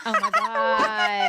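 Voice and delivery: high, slow drawn-out oh my God; amused disbelief. Real, not acted. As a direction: stretch it out in amused shock. Reacting to Bill Clinton home.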